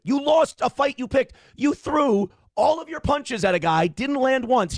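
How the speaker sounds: ordinary delivery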